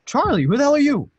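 loud, high-pitched retort; indignant challenge. Acted. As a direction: throw the question back at a stranger, loudly. Confronting a stranger.

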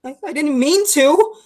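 timid excuse. Acted. Younger brother answers his angry older brother, timid pleading. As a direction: plead your innocence, faltering.

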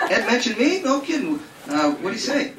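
high, excited mock Ronnie, laughing; eager Ronnie. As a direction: play the flattered dupe. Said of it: "this is Ronnie".

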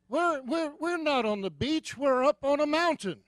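high-pitched, stammering disbelief; hammed-up bewildered protest; incredulous protest. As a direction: object in baffled alarm, voice pushed up. Retold protest at cliff-top dive.

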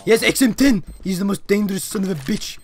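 loud, high triumphant yelling; excited shout. Real, not acted. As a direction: yell it out in pure triumph. Celebrates winning the round.

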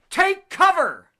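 slow, loud, high mock warning; mock alarm. Space station message read out, hammed-up alarmed shout. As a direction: shout it like a panicked radio call. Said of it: "Did you see that?".

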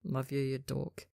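low, hushed, murmured fondly; tender drowsy murmur; soft mumble. A narrator's reading. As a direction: mumble it half-asleep to your partner. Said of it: "as he mumbles".

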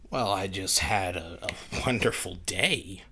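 usual speaking voice

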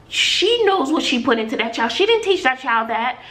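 ordinary talk, nothing marked

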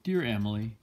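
plain reading voice

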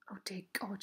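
soft, hushed prayer of dismay; softly. A narrator's reading. Mother on the farmhouse steps hearing of war, soft and hushed. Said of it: "she said softly".

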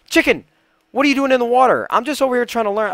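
high, loud playful telling-off; exasperated scolding. Real, not acted. Gamer scolds a chicken in the water, high exasperated shout. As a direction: call out and scold, pitch way up.

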